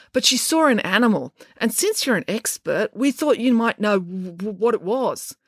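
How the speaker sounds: politely halting explanation; hesitant stammer. A narrator's reading. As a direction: stumble on the W near the end. Joe's stammer voiced in story.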